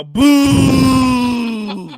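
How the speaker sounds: high, loud booing shout. Pretend. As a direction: boo like a heel's fan. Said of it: "I'm like Wade right now".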